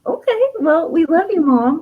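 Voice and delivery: put-on high, sweet child voice; little kids' reply. Small children answering mom in a high voice. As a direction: chirp it back like a small child. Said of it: "they're staring at me like".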